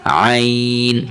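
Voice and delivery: long, drawn-out عین pressed slightly in the throat; ain from the throat. Shown as a demonstration. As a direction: stretch عین slowly, squeezing the throat a little. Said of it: "عین کی آواز گلے سے تھوڑا سا دبا کے". (عین کو گلے سے دبا کر، لمبا کھینچ کر)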